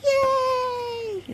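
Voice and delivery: high loud cheer. Real, not acted. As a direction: cheer for the happy end. Cheering at story end.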